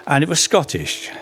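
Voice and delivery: normal talking voice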